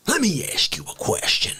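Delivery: hushed mock threat; a conspiratorial whisper. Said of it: "How Dare You Drag Racer".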